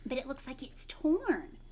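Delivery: usual speaking voice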